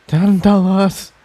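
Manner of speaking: plain reading voice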